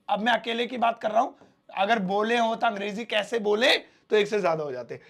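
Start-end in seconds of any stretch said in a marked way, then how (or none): none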